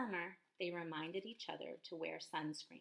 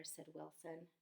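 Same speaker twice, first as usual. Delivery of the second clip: usual speaking voice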